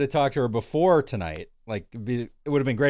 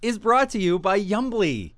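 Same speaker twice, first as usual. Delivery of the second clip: mock ad read; 7 semitones higher, 4 dB louder than usual. Mock sponsor read, slowed.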